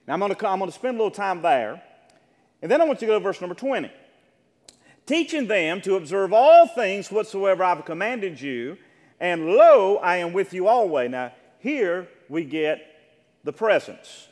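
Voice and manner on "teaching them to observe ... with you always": loud, raised-pitch Bible reading; Scripture proclamation. Acted. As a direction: proclaim the verse with heavy, deliberate emphasis. Reads Scripture aloud to congregation.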